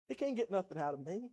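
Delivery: ordinary talk, nothing marked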